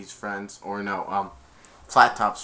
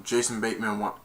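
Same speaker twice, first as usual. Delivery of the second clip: ordinary delivery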